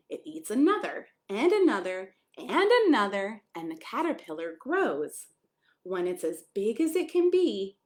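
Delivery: gentle picture-book read-aloud; storybook voice for a small child. Acted. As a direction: read it like a bedtime story to a little one.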